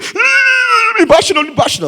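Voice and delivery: high-pitched squealing plea, hammed up; mock frightened begging. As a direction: squeal and beg like a coward. Gripped man begs not to be hit. (súplica aguda e apavorada, imitada)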